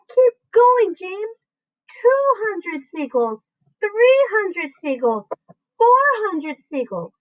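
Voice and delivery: high, slow chanted count; excited cheering. A narrator's reading. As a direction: urge him on as the count climbs. Cheering him on.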